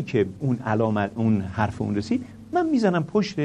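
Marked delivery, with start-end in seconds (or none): 2.5-3.4 lightly voiced boss giving orders, higher; a hint of a firm director instructing; mock instructions, pitched up; imitating the director. Mr Moradi instructing, voiced in story.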